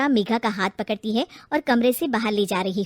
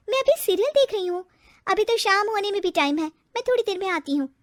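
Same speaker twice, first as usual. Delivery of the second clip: put-on higher, careless young bride brushing it off; a lightly raised, offhand daughter-in-law; lazy bride voice; 7 semitones higher than usual. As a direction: put her off without moving. Bride brushes off cooking request.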